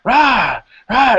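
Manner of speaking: high, pumped-up coaching voice; hype-man shouting. Retold or imitated. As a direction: fire him up like a locker-room hype man. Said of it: "and he's like".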